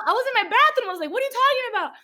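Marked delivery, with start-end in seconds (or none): 1.1-1.9 high-pitched mock bewilderment; baffled. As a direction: ask it way up high, acting lost. Acts out baffled reply to mom.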